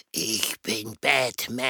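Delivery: tiefe, langsame Heldenstimme; Batman-Stimme. Pretend. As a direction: sprich wie ein düsterer Superheld. Eule spielt Batman nach. (tiefe Batman-Stimme)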